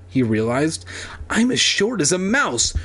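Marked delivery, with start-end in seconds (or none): 1.3-2.7 high panicked voice. Acted. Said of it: "Evan thought bitterly".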